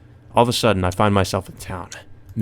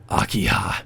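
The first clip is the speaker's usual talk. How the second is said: whispered name; 3 dB quieter than usual. Acted. Killer sees his sister walk by, whispering her name.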